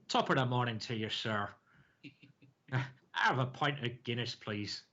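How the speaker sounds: half-hearted stock Irish accent; badly faked Irish accent. Shown as a demonstration. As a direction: have a poor stab at sounding Irish. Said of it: "I wanna hear your best Irish accent".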